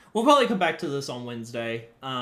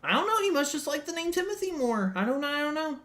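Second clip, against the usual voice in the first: mock innocent dad; 11 semitones higher than usual. Dad faking innocence to bystanders, higher voice. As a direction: play dumb innocently. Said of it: "And I'll be like".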